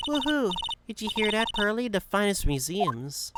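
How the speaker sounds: high, excited character voice; whoop, then share the thrill, pitched high; delighted cheer. Acted. Maya crowing to Pearly about the museum, high and delighted.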